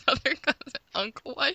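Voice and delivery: incredulous. Real, not acted. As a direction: repeat it in disbelief. Incredulous echo.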